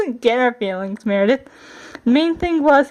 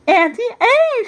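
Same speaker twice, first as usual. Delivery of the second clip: high, sing-song false politeness; pretend polite; 10 semitones higher, slower than usual. A narrator's reading. Princess greeting her aunt at the door, pretending to be polite. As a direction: greet with put-on sweetness. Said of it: "pretending to sound polite".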